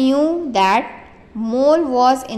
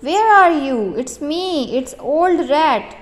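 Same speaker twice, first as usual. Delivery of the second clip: calling out; 4 semitones higher, 3 dB louder than usual. Acted. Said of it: "He called Mole".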